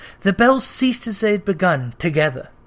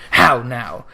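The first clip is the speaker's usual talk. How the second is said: caustic and cold; 7 semitones lower, 4 dB louder than usual. A narrator's reading. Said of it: "caustic and cold as ever".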